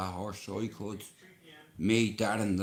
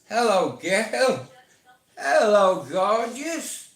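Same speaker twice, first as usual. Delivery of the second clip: much higher, louder sing-song greeting; sweet-talking a horse; 10 semitones higher, 8 dB louder, slower than usual. Shown as a demonstration. Man approaching a horse with an apple, loud sing-song coo. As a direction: coo at her like she's a beauty. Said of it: "I go up to you and I say".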